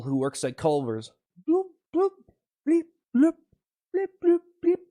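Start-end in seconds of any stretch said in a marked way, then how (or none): 1.5-4.8 high little bloops and bleeps; playful high-pitched blips; bubble noises. Pretend. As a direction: pop out tiny high bubble sounds. Pretending to be a bubble.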